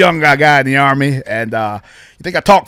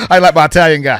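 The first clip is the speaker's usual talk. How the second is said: usual speaking voice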